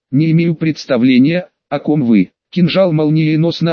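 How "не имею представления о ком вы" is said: usual speaking voice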